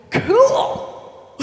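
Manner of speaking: high, loud, hammed-up thrill; excited. Peter looking at Jesus while water-walking, acted high-pitched excitement. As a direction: whoop the word like a thrilled kid. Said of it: "being excited".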